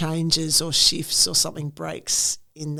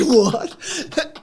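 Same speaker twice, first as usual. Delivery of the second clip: laughing mock confusion; 11 semitones higher, 5 dB louder than usual. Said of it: "I was like".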